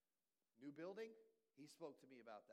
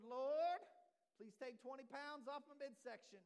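much higher and louder, a mock begging cry; hammed-up pleading prayer; 9 semitones higher, 11 dB louder than usual. Pastor mocking a prayer to lose weight, in a loud, high begging cry. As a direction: beg the Lord like it's a comic emergency.

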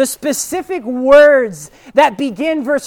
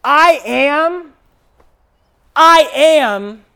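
"I am" said twice with a pause between; emphatic repetition; 3 dB louder, much slower than usual. Shown as a demonstration. Preacher showing how the Greek sounded, "I am" twice, emphatically. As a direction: repeat "I am" as two separate declarations. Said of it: "This is what it would have sounded like".

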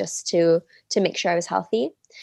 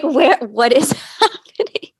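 high, loud, laughing mock cry; laughing bewilderment; 5 semitones higher, 6 dB louder than usual. Woman watched by strangers mid-birth, laughing bewildered cry. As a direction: burst out baffled, breaking into laughter.

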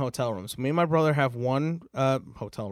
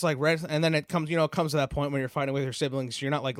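ordinary talk

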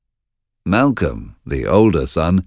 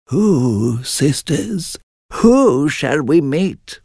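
lightly voiced slow witch; a hint of a croaky hag, slow; third witch; 8 semitones higher, much slower than usual.

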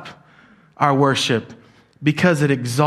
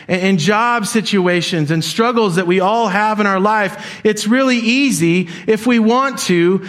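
higher, driving sermon voice, pressing each point home; impassioned preaching; 6 semitones higher than usual. Acted. Pastor urging the church to value Jesus over success, fervent preaching. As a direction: preach it out with rising conviction.

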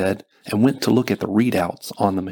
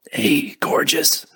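tender; 6 semitones higher, slower than usual. A narrator's reading. Injured man greets his love.

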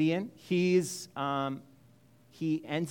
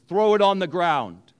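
commanding God; 7 dB louder than usual. Acted.

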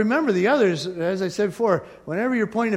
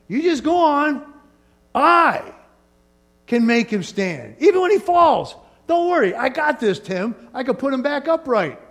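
higher and louder, a hammed-up God reassuring Tim; God speaking firmly; 6 semitones higher, 4 dB louder than usual. God promising Tim he will stand, higher and louder. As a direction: boom out God's promise to Tim. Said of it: "So God says".

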